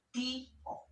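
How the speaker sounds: TI, O in two separate syllables, stress on TI; hiatus demo. Shown as a demonstration. Teacher showing an accented closed vowel, split syllables. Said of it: "la vocal cerrada va con tilde".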